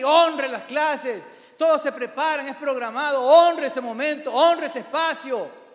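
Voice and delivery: loud, high-pitched pulpit exhortation; impassioned preacher at full voice; forceful preaching. Real, not acted.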